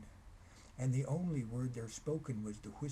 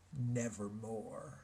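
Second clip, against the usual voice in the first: slow, drawn-out ominous raven croak; raven's reply; 2 semitones lower, 3 dB quieter, much slower than usual. A narrator's reading. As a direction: let the bird toll the word slowly. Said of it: "Quoth the raven".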